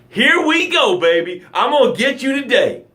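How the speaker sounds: singsong threat from Life sitting on your bed; taunting Life. Retold or imitated. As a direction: play Life as a cocky bully relishing the fight. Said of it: "Life is like".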